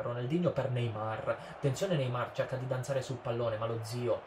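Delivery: usual speaking voice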